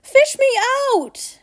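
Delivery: urgent high cry; yelling. A narrator's reading. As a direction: yell it high, drop on the last word. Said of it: "he yelled".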